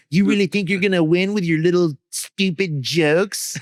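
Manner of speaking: lightly voiced mocking teen girl; Mean Girls taunt. Dance team mocking his jokes, a mild mean-girl sneer. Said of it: "like, Mean Girls style".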